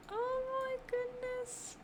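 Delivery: high-pitched squeal of delight. Real, not acted. Gamer squealing at a cute park build, delighted. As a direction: squeal like you just saw puppies.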